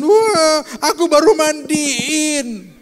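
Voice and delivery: put-on high, slow wailing child voice, half laughing; crying child. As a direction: wail like a little kid caught out. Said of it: "Terus dia nangis kan". (anak merengek menangis, pelan dan tinggi)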